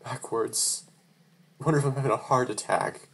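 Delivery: ordinary delivery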